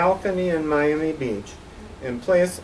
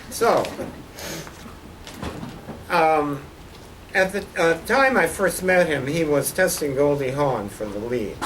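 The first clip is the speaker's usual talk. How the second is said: ordinary talk, nothing marked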